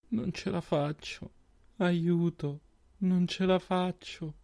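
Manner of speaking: high, slow, broken begging; desperate plea. Acted. Overwhelmed girl begging for help, slow and despairing. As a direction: fall apart quietly, pleading.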